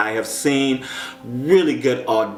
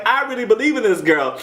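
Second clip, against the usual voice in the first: lightly voiced, higher, earnest gush; gushing Nikki; 8 semitones higher than usual. Vlogger quoting Nikki praising a contestant, gushing higher. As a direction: play up Nikki's heartfelt belief. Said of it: "She was like".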